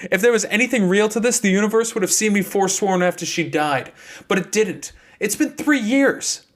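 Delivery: heated. Acted. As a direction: push back hard. Seth keeps arguing angrily.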